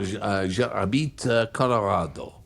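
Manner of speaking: Colorado said the French way, kho-lo-RA-do, light and even; mock French accent. Co-host shows off his French accent on Colorado. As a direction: give Colorado a French spin. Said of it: "Nice accent".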